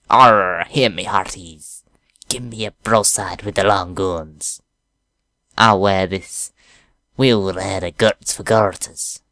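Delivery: slow hammy pirate captain. Acted. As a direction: bark orders like a pirate. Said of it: "I even been working on my pirate voice".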